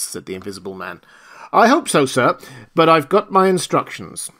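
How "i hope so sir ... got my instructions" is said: higher, louder, a lightly voiced dutiful constable; apologetic but firm officer; polite constable. Constable Jaffers to the stranger, lightly voiced and dutiful.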